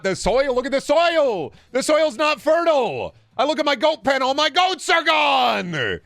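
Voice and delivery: high, loud, hammed-up wail; mock despair. Streamer laments barren crops to chat, wailing in mock despair.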